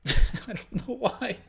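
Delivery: slow, laughing through the words, high; sheepish laugh, words drawn out. Real, not acted. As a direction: laugh while admitting the slip. Laughs at own mistake.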